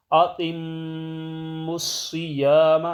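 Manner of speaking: اَتِمُّ الصِّيَامَ slow, with a nasal hold on مّ and a heavy ص; tajweed recitation. Shown as a demonstration. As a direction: recite it as taught, slowly. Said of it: "ایسے پڑھنا ہے".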